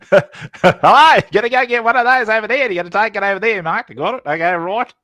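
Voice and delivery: loud, high, hammed-up Australian accent. Host imitating an Australian explaining crypto, loud Australian accent. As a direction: rattle it out as an excitable mock Australian. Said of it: "if an Australian explains it".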